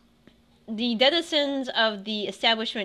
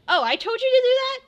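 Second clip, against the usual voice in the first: much higher, fast and laughing; teasing mentor; 8 semitones higher, 3 dB louder, faster than usual. Acted. Mentor laughing off her own training prank, fast and high. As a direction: tease the student, cackling at the prank. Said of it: "she would be like".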